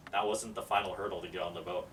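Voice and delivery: ordinary delivery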